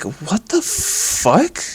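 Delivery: high, slow incredulous gasp of disbelief; shocked disbelief. Retold or imitated. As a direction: stretch it out in stunned disbelief. Retelling own shocked reaction.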